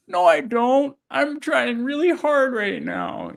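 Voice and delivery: high, drawn-out tearful whine; mock tearful protest. Young salesman protesting to the guy at the door, mock tearful. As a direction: act out pleading on the verge of tears. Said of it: "like, crying".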